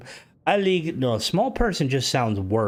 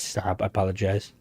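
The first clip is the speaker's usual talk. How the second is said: ordinary delivery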